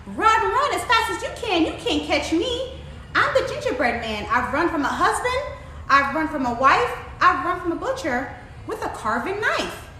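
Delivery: high, sing-song boastful taunt; taunting gingerbread man. A narrator's reading. Gingerbread man brags to the muddy old sow, taunting. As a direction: crow it playfully, stretching the key words. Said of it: "put his hands up on his hips and said".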